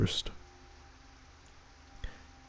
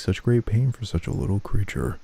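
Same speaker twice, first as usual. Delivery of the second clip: ordinary talk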